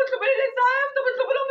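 high-pitched pleading; retold appeal for help. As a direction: re-enact an urgent plea to onlookers. تستغيث وهي تنزف في الحكاية. (استغاثة محكية بصوت أعلى)

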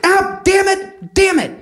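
high strained yelp of pain, drawn out; pained cursing scientist. Acted. Scientist hurt by his own machine, high pained cursing.

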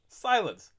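ordinary delivery

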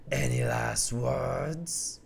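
very slow, low, soft taunt; lion taunts before pushing the cow; villain lion voice. Acted. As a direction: savour the question cruelly.

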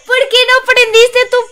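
queja frustrada. Real, not acted. Pierde la partida, protesta.

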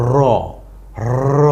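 র alone, the হ silent. Shown as a demonstration. Teacher models হ্র as র. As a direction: clear র with no h sound. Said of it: "উচ্চারণ হবে".

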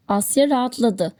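ordinary delivery

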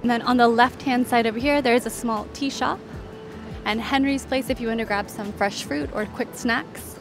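normal talking voice

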